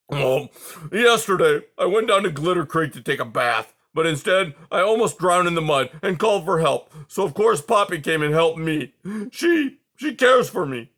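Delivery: put-on an old porcupine tumbling through his tale; agitated old porcupine. A narrator's reading. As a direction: Aerith telling the mice about Poppy, agitated and loud. Aerith tells his story.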